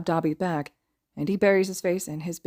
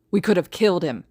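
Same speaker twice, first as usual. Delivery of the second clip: angry cry; 6 dB louder than usual. A narrator's reading. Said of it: "Dabi cries".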